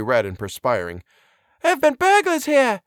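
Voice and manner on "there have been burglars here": very high-pitched, flustered housekeeper; excited cry. A narrator's reading. Housekeeper reporting burglars to the doctor, excited cry. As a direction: cry out the news in a high put-on voice. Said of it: "she cried excitedly".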